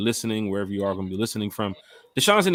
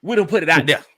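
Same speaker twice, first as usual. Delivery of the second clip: big country voice; 5 semitones higher, 8 dB louder than usual. Shown as a demonstration. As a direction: boom it out like a big country preacher. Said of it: "I'm gonna use my big country voice in a minute".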